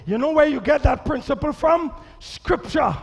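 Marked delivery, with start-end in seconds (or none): none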